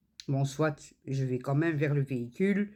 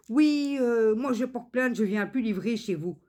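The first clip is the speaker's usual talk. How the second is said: high, louder mock of the courier; imitating angry courier; 7 semitones higher, 4 dB louder than usual. Customer mimics the courier refusing to deliver again. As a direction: huff it out like a petulant threat.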